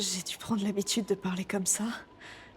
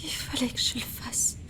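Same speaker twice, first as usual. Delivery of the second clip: breathy whisper, barely voiced; whispered confession. Girl confessing to her companion after leaving her friend, whispering. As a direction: hush it like an admission to yourself.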